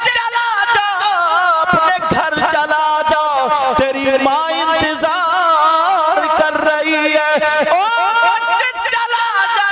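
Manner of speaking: loud, chanted sermon storytelling; preaching at full force. Acted. Preacher to the congregation, telling the Prophet's story, fervent chanting preaching. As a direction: preach it with swelling fervour, half sung.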